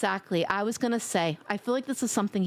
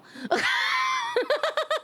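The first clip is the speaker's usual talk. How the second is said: shrieking laugh. Real, not acted. Streamer cracking up at her own bit, shrieking laugh. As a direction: burst out laughing.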